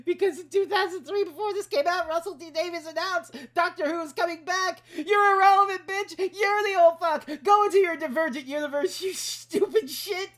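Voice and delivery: loud, high-pitched shouting; furious rant. Real, not acted. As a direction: yell it as a crushing put-down.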